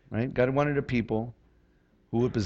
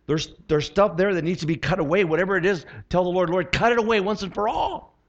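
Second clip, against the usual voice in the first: higher and louder, pressing the congregation; rising fervent exhortation; 7 semitones higher, 4 dB louder than usual. Real, not acted. Preacher urging congregation to pray, fervent and rising. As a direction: build into an urgent plea.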